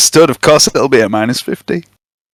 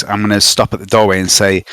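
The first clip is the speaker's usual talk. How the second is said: ordinary delivery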